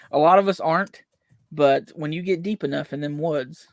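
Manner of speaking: ordinary talk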